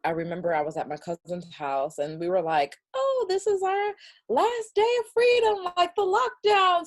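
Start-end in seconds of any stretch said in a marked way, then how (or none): 2.9-6.9 high, loud party announcement; big whooping excitement, pitched way up; stretched-out, high-pitched celebratory cry; excited last hurrah. Retold or imitated. Cousins declare last day of freedom.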